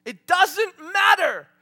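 starting plain, rising to loud, high proclamation; building to a shout. Real, not acted. Preacher declaring his trust, building to a loud cry.

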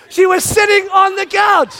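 dad outraged she was just sitting there; exasperated. Shown as a demonstration. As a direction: stress sitting indignantly. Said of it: "repeated louder".

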